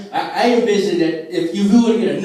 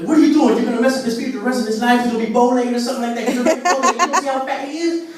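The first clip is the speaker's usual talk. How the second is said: dad yelling; 6 semitones higher, faster than usual. Retold or imitated. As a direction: blast the salesman like a furious father. Dad yelling at shoe salesman.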